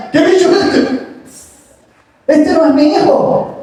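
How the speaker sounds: high, startled cry with a laugh; shocked mother. Acted. Waking mother startled to find a stranger's baby, shocked high cry.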